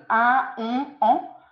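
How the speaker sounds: "un", "on", "en" as separate nasal vowels; each of "un", "on", "en" said apart, through the nose; nasal vowel demo. Shown as a demonstration. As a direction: list the nasal sounds "un", "on", "en" one at a time. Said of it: "les sons nasaux".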